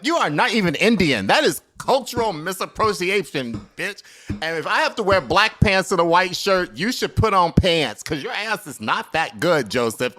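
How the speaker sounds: loud, high, sassy scolding of a rival waiter; sassy callout. Retold or imitated. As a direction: read him loudly and sassily for his costume. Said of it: "I would be like".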